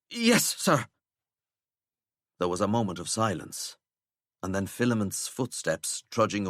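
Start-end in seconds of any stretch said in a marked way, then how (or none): 0.1-0.9 defeated yes sir. Acted. Prefect gives in.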